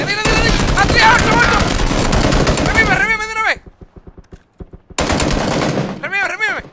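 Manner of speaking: very high, loud yelling in battle; frantic shouting. Real, not acted. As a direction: scream the orders at the top of the voice. Frantic fight, teammate down.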